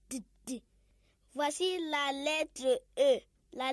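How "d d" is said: "D" as a short voiced d sound; letter sound D. Shown as a demonstration. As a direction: sound out "D" softly twice. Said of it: "elle fait toujours D". (son de la lettre D)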